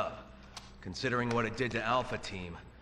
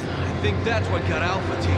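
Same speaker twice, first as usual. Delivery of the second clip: loud and higher, over the noise; alarmed shout; 8 semitones higher, 10 dB louder than usual. Acted. Agent warns his partner about the monster, shouting. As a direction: call it out as the creature appears.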